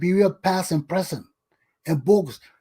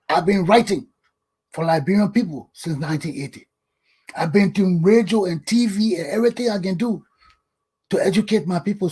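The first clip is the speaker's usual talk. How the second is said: normal talking voice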